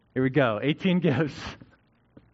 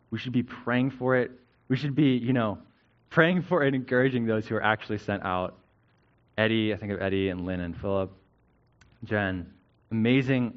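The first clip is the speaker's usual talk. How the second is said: normal talking voice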